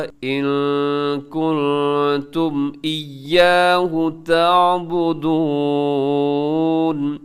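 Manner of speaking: slow chant, humming the n in इन and कुंतुम; voice hidden in nose. Shown as a demonstration. Teacher modelling both ikhfas for students, nasal hold in इन कुंतुम. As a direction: linger nasally in "इन कुंतुम", stretch "ताबुदून" long. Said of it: "दोनों में नाक में आवाज़ को छुपाइएगा".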